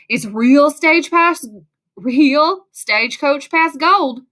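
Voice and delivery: put-on slow, drawn-out cheesy Southern accent. A narrator's reading. Gold mine man telling kids the gold is real, cheesy Southern accent. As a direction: ham up a slow Southern twang. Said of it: "with a cheesy Southern accent".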